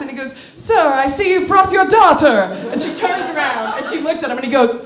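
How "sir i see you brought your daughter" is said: high, loud, slow host voice; imitated maitre d'. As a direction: announce it grandly like a maitre d'. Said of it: "the maitre d' of the cruise comes over".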